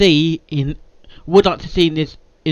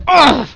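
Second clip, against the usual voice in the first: frustrated groan; 8 semitones higher, 6 dB louder than usual. Real, not acted.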